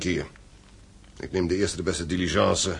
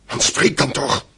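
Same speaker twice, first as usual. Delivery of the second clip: loud, desperate demand, higher; urgent shout; 8 semitones higher, 8 dB louder, slower than usual. Acted. Father shouting at the silent innkeeper for news, urgent. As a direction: shout at him to finally speak.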